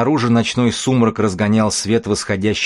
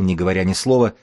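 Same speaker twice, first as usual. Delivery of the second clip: usual speaking voice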